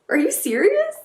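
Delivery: high-pitched astonished question; stunned disbelief. Real, not acted. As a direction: ask it in real, delighted shock. Stunned by unboxed product.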